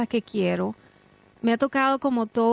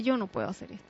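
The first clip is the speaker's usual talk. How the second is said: defeated self-quote; 8 dB quieter, much faster than usual. Retold or imitated. Recalling her lowest moment to listeners, softly defeated. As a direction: say it as if beaten. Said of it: "yo dije".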